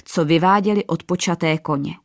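ordinary delivery, nothing marked

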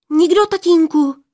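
high, loud, hurried denial; hasty blurting. A narrator's reading. Daughter hiding the stranger from her father, blurted denial. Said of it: "vyhrkla Poměnka".

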